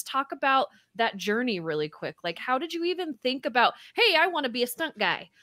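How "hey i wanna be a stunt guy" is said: ordinary delivery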